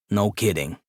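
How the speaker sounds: office worker voice. Acted. Office man voiced in story.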